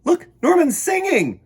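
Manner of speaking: high, loud delighted exclamation; excited kid pointing out his fish. Acted. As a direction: blurt it out thrilled.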